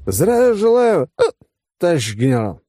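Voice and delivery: high, loud greeting with a hitch; drunken military salute. Acted. Tipsy officer salutes his general, loud and halting. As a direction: draw out the salute, stumble, finish.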